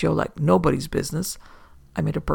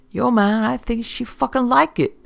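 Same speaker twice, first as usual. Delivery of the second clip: gloating thug; 8 semitones higher than usual. A narrator's reading. Thug gloating over victim.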